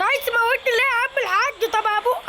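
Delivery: panicked protest. Real, not acted. Protesting at the balloon operator.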